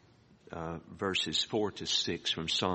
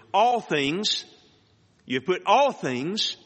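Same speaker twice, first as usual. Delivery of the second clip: "all" raised high and louder, rising on "all things"; stress on ALL; 10 semitones higher, 6 dB louder than usual. Shown as a demonstration. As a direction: lift the word "all" up and lean on it. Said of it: "that's the emphasis there".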